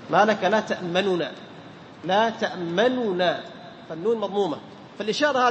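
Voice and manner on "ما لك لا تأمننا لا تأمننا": تأمننا said slowly with both nuns clearly sounded; original form shown. Shown as a demonstration. Teacher showing the original form to students, slow and careful. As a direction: spell out تأمننا slowly, each nun audible. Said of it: "لأن في الأصل إيش".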